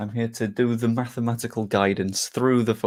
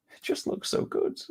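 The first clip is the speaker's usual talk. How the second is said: much higher, whispered swoon over the shirt; breathy swoon; 6 dB quieter than usual. Real, not acted. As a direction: squeak out pure longing. Admiring the new shirt.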